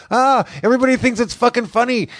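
high-pitched imitated griping; brother impression. Brother griping at being laughed at, high mimicking voice. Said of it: "he talk like this".

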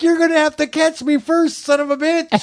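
high, strained cocky retort; mock defiant taunt. Storyteller as teen daring the man to catch him, high mock taunt. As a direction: jeer it high, ready to bolt.

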